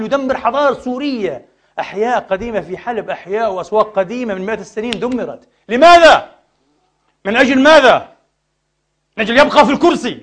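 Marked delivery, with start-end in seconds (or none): 5.7-10.2 high, loud demanding questions; preacher's outraged demand; outraged cry. Real, not acted. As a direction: cry out the why.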